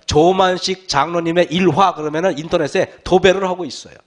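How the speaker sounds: ordinary delivery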